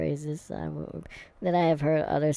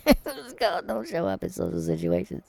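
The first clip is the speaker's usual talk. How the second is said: higher-pitched, laughing through the joke; 6 semitones higher than usual. Real, not acted. Joking about the script for social situations, laughing. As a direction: blurt it out on a real laugh.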